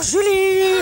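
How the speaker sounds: name sung out high and loud; calling out. Acted. Casimir calling Julie across the garden, high loud call. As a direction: call her name from afar.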